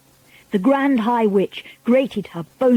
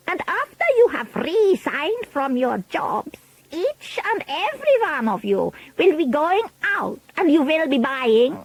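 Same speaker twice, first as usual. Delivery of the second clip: put-on higher, accented, imperious witch voice; Grand High Witch; 7 semitones higher than usual. A narrator's reading. Witch leader demanding silence from her followers, imperious voice. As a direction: give orders as a commanding villain.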